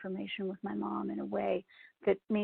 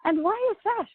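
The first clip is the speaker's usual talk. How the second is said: put-on much higher, louder little Irish-accented question; 12 semitones higher, 6 dB louder than usual. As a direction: mimic mom's sweet Irish lilt. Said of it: "in her little Irish accent".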